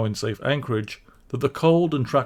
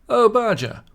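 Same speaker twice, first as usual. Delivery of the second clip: much higher and louder, pleading cry; cried out; 11 semitones higher, 6 dB louder than usual. Acted. Rat cries out to Badger, pleading. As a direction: call out in relief. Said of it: "cried the rat".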